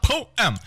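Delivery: "Poem" said high as POH-EM; high, insistent "Poem" with both syllables sounded; emphatic two syllables. Acted. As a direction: exasperatedly split "Poem" into two beats. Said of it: "Literally just say it out loud".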